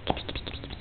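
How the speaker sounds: whispered kitty kitty coax; whispering. Real, not acted. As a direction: breathe it out playfully. Whispered coaxing to baby.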